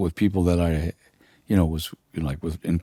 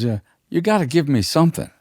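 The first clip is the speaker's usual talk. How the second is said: insistent [name]; 9 semitones higher than usual. Retold or imitated. [name] repeating demand.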